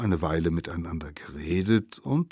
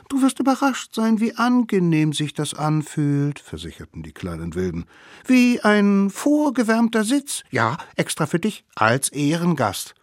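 put-on much higher, cheeky kid voice; sly children plotting a trick; high scheming kid voice; little wild kids; 10 semitones higher than usual. Kids reassure.

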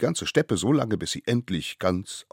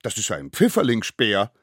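put-on high, loud child insisting on the excuse; eager little accomplice; 8 semitones higher, 9 dB louder than usual. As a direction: pile on the evidence in a squeaky, loud voice. (hoch und laut beteuerndes Kind)

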